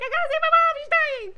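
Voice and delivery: high, loud frantic cry; mock frantic hero. Mocking the hero panicking over his dying mom, high and loud. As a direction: squeal it in a panic. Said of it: "he's like".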